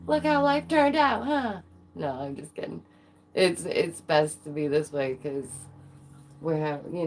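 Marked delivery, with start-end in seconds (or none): 0.1-1.6 high, loud mock lament; mock dismay. Streamer kidding about how her life went, mock dismay. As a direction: kid around in a high, put-upon voice. Joking complaint, then "just kidding.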